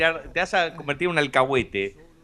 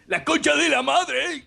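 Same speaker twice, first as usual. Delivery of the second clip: slow, drawn-out metal singer impression, pitched higher; voice from beyond the grave; 11 semitones higher, slower than usual. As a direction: drag it out like a metal frontman. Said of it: "habla así con la voz de ultratumba".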